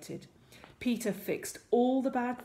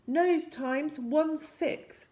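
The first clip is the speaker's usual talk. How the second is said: high, drawn-out incantation; casting a spell; 8 semitones higher, much slower than usual. A narrator's reading. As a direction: proclaim it slowly as a magic spell. Hero casts a spell.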